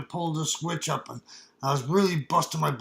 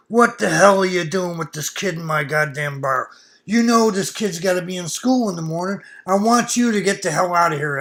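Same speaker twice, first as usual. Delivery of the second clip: louder but not full-scream scolding; bar owner screaming; 2 semitones higher, 7 dB louder than usual. Retold or imitated. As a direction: tear into him for bringing a kid to the bar. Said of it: "her screaming at him".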